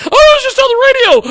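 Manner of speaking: squealing very high and loud; shrieking like she just heard it on air; mock shriek. Mock panic of the woman who answered, very high shriek. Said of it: "she's probably like".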